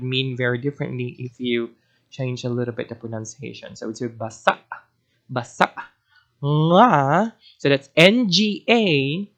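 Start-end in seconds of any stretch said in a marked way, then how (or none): 4.0-5.7 basa met glottisslag aan het eind; glottal catch at the end. Shown as a demonstration. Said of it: "make sure that there is a glottal catch there at the end". 6.4-7.3 nga nasaal uitgesproken; nasal nga. Shown as a demonstration. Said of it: "Very nasal".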